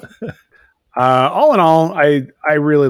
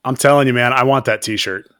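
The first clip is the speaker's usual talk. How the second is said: normal talking voice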